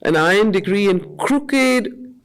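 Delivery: ordinary delivery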